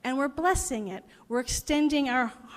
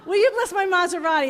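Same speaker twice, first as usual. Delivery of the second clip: higher, louder eager plea; eager asking; 7 semitones higher, 8 dB louder, faster than usual. Retold or imitated. Car owner eagerly asks the priest for a blessing. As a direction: ask it brightly and hopefully.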